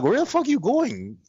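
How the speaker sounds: incredulous call-out. Retold or imitated. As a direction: call after him in disbelief. Retold calling out to friend.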